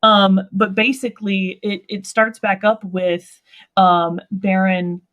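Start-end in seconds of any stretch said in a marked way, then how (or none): none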